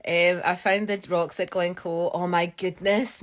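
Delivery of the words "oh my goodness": ordinary delivery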